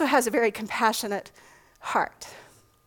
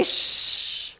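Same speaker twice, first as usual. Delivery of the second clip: a soft voiceless "shh"; just the sh sound of the name, breathed out; tiny hushed sh; mock sh; 5 dB quieter than usual. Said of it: "made fun of me because it got to where I would just say".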